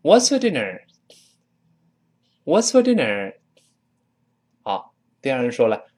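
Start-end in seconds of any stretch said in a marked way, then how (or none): 0.0-3.3 "What's for dinner?" pitched high with a short, weak "for"; high, linked "What's for dinner?"; model reading. Shown as a demonstration. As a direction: demonstrate "What's for dinner?" for learners, high then falling. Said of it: "再来一遍".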